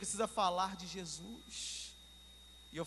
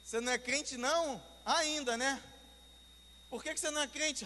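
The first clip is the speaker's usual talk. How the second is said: higher-pitched, playful challenge; mock challenge; 7 semitones higher, 4 dB louder than usual. Preacher challenging the taxi driver's unbelief, teasingly incredulous. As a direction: re-enact pressing someone for an answer.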